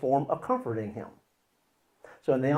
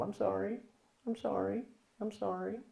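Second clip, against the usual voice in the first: soft, higher, rising apology; guilty apologizing; 7 semitones higher, 11 dB quieter than usual. Acted. Guilty part blamed as a child, apologizing softly. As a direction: keep apologizing meekly. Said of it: "Part that's my fault".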